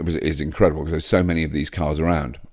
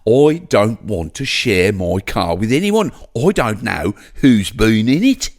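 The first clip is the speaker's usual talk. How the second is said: a put-on Brummie voice, drawn out and raised; a slow, raised Birmingham accent that sounds put on; 3 semitones higher, 4 dB louder, slower than usual. Shown as a demonstration. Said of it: "Brummie is a different accent. It's more like that".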